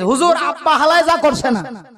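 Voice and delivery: put-on laughing old Dhaka dialect reply; cheeky son in Dhaka slang; mock cheeky Dhaka boy; Dhaka son answering. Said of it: "ছেলে এখন জবাব দিতেছে".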